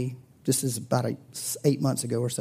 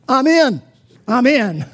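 high, loud eager shout; higher, louder excited outburst; 10 semitones higher, 11 dB louder, slower than usual. Retold or imitated. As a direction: leap in with a loud I'm in. Friend jumps up from table.

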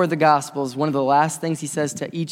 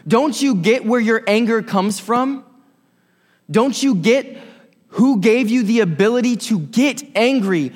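loud, high, driving sermon delivery, Paul's words hammered out; full-force preaching; 6 semitones higher, 5 dB louder than usual. Acted. Preacher rallies the angry congregation, loud and high. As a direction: rouse the congregation with a raised, ringing voice.

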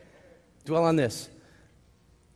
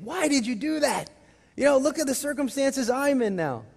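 higher, louder mock scolding of Adam and Eve; mock exasperated complaint; 10 semitones higher, 4 dB louder than usual. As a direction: whine it up high like a frustrated believer. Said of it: "have you ever gotten mad at Adam and Eve".

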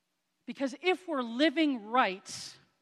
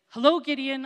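calling out to Gideon; 5 semitones higher, 11 dB louder than usual. Retold or imitated. God answers Gideon's doubts.

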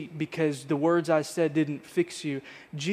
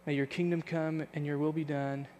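prayer. Acted. Pastor praying for church at service close, earnest prayer voice. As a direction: pray it over the congregation, measured and sincere.

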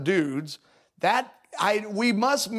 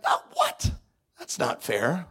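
indignant protest; 2 semitones lower than usual. Retold or imitated. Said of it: "We're like".

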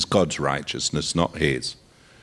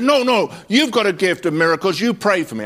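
higher, louder pleading voice; pleading man; 9 semitones higher, 5 dB louder, faster than usual. Retold or imitated. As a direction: imitate the man begging to be prayed for.

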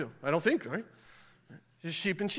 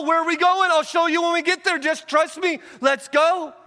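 rising, louder sermon build; preaching at full force; 5 semitones higher, 3 dB louder than usual. Acted. Preacher rising toward Jesus' call. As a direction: climb toward a call to follow.

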